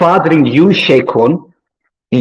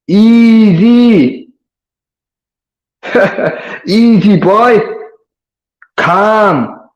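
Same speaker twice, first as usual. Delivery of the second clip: mocking calm down; 6 semitones higher, much slower than usual. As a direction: talk him down like an overexcited animal. Telling rival to calm down.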